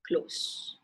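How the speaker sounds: همس; whispered gloss. Shown as a demonstration. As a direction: Close breathed as a whisper.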